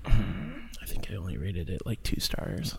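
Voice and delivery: hushed, sheepish whisper. Real, not acted. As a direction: whisper the rating like a confession. Whispered low rating confession.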